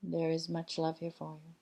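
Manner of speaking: measured, calm channeling of a collective "we"; channeled spirit voice. Acted. Guiding entity addresses listeners, calm channeled message. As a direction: speak as the guiding entity, unhurried and gentle.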